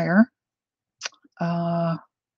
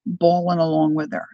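normal talking voice

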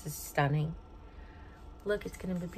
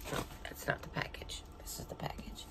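whispering to herself; 12 dB quieter than usual. Real, not acted. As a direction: whisper it under your breath. Muttering to herself while searching.

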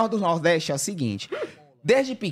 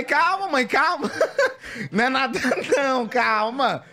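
laughing reassurance; 4 semitones higher, slower than usual. Retold or imitated. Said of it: "eu falei".

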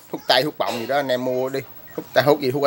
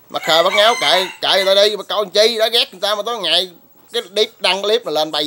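indignant; 6 semitones higher than usual. Real, not acted. Ranting at haters on his channel.

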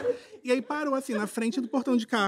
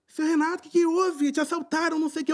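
higher, concerned doorman asking what happened; doorman's worried voice; 7 semitones higher than usual. Retold or imitated. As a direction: play the startled doorman checking on him. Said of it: "O porteiro".

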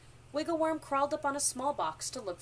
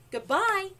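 high, loud farewell; storybook holler; loud shout; 9 semitones higher, 8 dB louder than usual. A narrator's reading. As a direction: shout goodbye after someone. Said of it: "as loud as he could".

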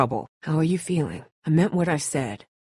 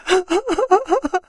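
distraught calling. Acted. Calling to injured Omid.

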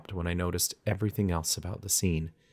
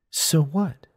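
high, louder defiant comeback; challenging; 9 semitones higher, 5 dB louder than usual. A narrator's reading. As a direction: throw it back as a dare, pitched up. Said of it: "she challenged".

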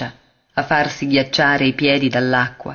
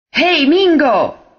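a loud, high, slow call from far away; rough shout from the distance; harsh distant shout; 12 semitones higher, 6 dB louder, slower than usual. Acted. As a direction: yell across the beach. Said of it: "urlò una voce aspra di lontano".

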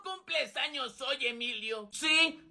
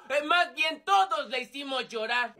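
usual speaking voice

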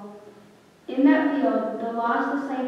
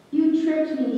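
Judas cries out at the high priest, louder; anguished outcry, a touch louder; crying out; 4 semitones higher, 5 dB louder than usual. A narrator's reading. Judas confronting the high priest, crying out. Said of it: "he cried".

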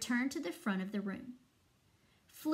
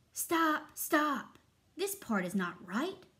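raised, high cries of stop, then firm; shouting; 5 semitones higher, 4 dB louder, slower than usual. A narrator's reading. Conductor halts the band over a wrong part, shouting. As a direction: call a sharp halt. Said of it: "he shouted".

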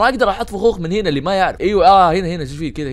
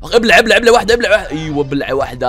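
loud, raised, rapid-fire repeated taunt; taunting attack yell; 4 dB louder than usual. Real, not acted. Gamer striking back at the enemy, yelling taunts loudly. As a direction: yell it with every blow. Hitting the startling enemy in game.

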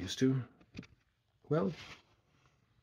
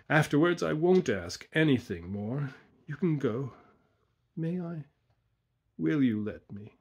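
higher, insistent plea; supplicating; 5 semitones higher than usual. A narrator's reading. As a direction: beg for one last favour. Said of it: "his voice full of supplication".

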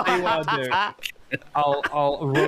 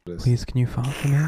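ASMR voice; 5 semitones lower than usual. Shown as a demonstration. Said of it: "A S M R voice".

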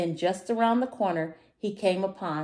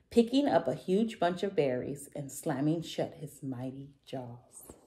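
plain reading voice

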